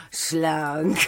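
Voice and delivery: high, loud mocking laughter. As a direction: laugh him off with scorn. Spottendes Lachen im Streit. (hohes, lautes spöttisches Lachen)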